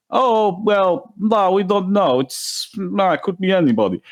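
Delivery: high, feigned innocence, playing dumb; mock innocent denial. Russia denying the hack to Ukraine, high mock innocent voice. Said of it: "it's gonna be".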